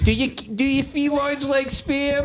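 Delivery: put-on high, kvetchy old lady voice. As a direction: play a whiny old New York bubbe.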